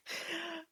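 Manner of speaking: very high cackling villain laugh; a gleeful evil cackle. Acted. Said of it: "As Shigaraki just starts laughing".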